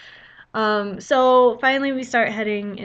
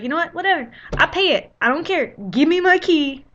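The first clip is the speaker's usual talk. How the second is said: higher and louder, done caring; exasperated; 6 semitones higher, 5 dB louder than usual. Retold or imitated. Guest to clerk over the fee, exasperated. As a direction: throw the money at it.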